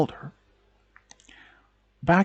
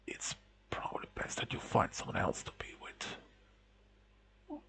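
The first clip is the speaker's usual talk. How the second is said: weak whisper; 4 semitones lower, 18 dB quieter than usual. A narrator's reading. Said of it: "words didn't come out strong enough".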